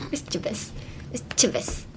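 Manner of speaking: "mischievous" in a near whisper, click-like "chi" in the middle; broken-down pronunciation. Shown as a demonstration. Teacher sounding out the hard word, "mischievous" softly with a clicked "chi". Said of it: "that would be how you say mischievous".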